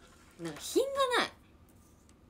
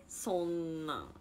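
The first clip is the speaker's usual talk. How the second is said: ordinary talk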